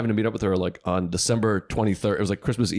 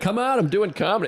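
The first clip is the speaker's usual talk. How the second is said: high, pleading mock self; high mock protest to his girlfriend; defensive plea; 11 semitones higher than usual. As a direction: whine it like a kid defending himself.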